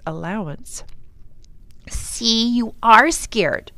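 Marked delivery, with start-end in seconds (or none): 1.9-3.7 slow, louder gloating taunt; a brother gloating that his sister is scared; triumphant. A narrator's reading. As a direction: crow over her. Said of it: "Tom said triumphantly".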